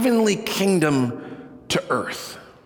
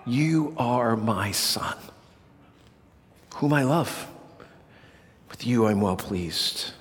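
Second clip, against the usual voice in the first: hushed voice of the Father; 6 semitones lower, 5 dB quieter than usual. Acted. Preacher repeating the Father's words to his Son, hushed and tender. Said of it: "this is the definitive voice of the Father".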